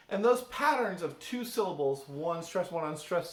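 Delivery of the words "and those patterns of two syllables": normal talking voice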